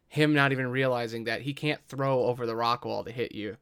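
usual speaking voice

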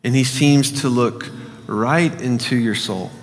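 hushed imaginative prayer guide; guided meditation. Acted. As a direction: lead the listener gently through the scene.

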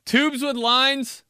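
high, loud, slow disbelief; mocking his own clueless answer; incredulous. Real, not acted. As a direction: repeat it in disbelief.

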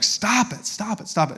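high-pitched exasperated retold 'stop it'; furious parent. Dad telling his daughter to stop throwing bath water, exasperated. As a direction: re-enact a fed-up parent's rising alarm. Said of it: "I'm getting furious".

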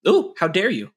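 playful fake outrage; mock offended. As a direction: act hurt in jest. Cut off mid answer.

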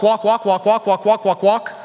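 evenly repeated word, no gaps; rapid-fire repetition. Shown as a demonstration. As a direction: rattle off a string of walks. Repeats passage word in rattled run.

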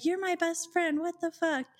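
high-pitched mock outrage; outraged disbelief. Girlfriend confronting cheating best friend, outraged. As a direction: imitate a betrayed, incredulous protest.

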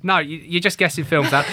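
ordinary delivery

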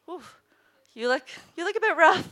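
lightly voiced assistant ribbing a filthy colleague; teasing assistant. Voicing teasing field assistant.

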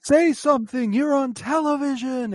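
high, loud imitation of a desperate command; shouted demand. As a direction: yell it way up high, demanding a response. Acting out the director's desperate demand.

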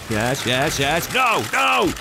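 excited mock photographer, climbing to high shouts; Austin Powers. Photographer directing the shoot, rising manic mock swinger. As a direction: build to loud, high, hammed-up cries. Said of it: "it's like Austin Powers".

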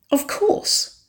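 high, airy mock scoff; teasing, high-pitched dismissal; playful scoff. A narrator's reading. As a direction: scoff lightly, as if it were obvious. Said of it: "Nicole scoffed playfully".

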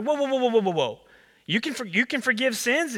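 high, alarmed whoas from the crowd; crowd stunned. Retold or imitated. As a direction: stop him in his tracks, shocked. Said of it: "And everyone's like".

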